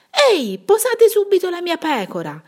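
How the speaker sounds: high, loud indignant yell; shepherd yelling at two brutes stealing his sheep; shout. A narrator's reading. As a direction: shout at the thieves to drop her. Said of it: "gridò il pastore allegro".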